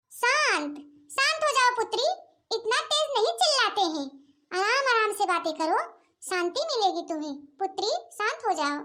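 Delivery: soft, low serene holy woman; calm yogini. Acted. Yogini gently hushes the shouting sisters, softly. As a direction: soothe her like a calm ascetic.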